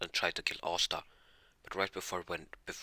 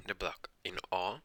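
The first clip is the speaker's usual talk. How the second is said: ordinary delivery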